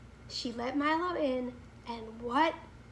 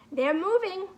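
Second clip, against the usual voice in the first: mild shout, a little higher; 3 semitones higher, 3 dB louder than usual. A narrator's reading. Milo sees the clock figures move, mild shout. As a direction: call out as the figures start moving. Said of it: "he shouted".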